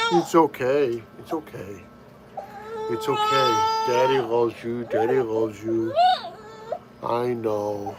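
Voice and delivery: gentle parent hush, pitched low; soothing. Real, not acted. Soothing his crying baby.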